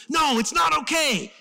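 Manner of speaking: high, loud panicked outburst; freaking out. Retold or imitated. Servant refusing Elisha's calm, freaking out. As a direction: shout back like a frantic servant. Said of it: "freaking out".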